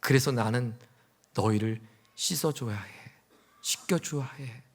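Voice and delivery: very soft, low and tender; hushed, loving resolve; tender Jesus. Acted. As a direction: say it gently, slowing at the end. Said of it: "이렇게 말씀하셨어요".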